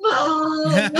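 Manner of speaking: high, hammed-up crying call; mock tearful wail. Injured daughter seeing her mom rush in, mock crying. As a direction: burst into exaggerated sobs calling for a parent. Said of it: "they just started crying".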